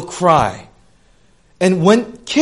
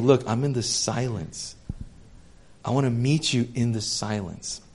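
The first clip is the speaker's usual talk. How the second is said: much softer, lower, hushed; soft God voice; 5 semitones lower, 11 dB quieter than usual. Retold or imitated. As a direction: speak of silence gently. God speaking softly of silence.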